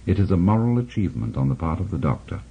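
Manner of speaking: ordinary talk, nothing marked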